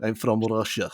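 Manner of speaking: a short, hammed-up Russian accent through a laugh; mock Russian accent. Teasing the Czech guest in a mock Russian accent. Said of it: "I'm not even gonna do the accent".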